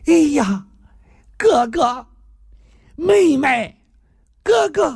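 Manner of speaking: high-pitched drawn-out calls; acted reunion cries. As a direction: call out to a long-lost sibling in amazement. Long-lost siblings call each other. (兄妹重逢，高声惊呼)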